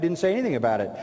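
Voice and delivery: usual speaking voice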